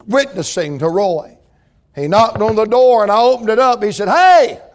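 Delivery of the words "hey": sudden called hey, higher and louder; calling out. Retold or imitated. Drunk Roy at the door, calling out hey. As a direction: call out to whoever answers.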